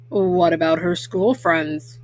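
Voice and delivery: a big papa bear asking gently; deep papa bear. Acted. Papa asks mama at the window, low voiced.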